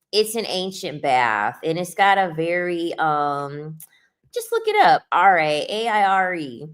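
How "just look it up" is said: much higher, louder push; insistent urging. Real, not acted. Telling viewers to look it up, high and insistent. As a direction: pitch it way up and push it.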